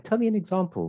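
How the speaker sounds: high-pitched imitation of an interviewer; mock interviewer. Voices a job interviewer's question.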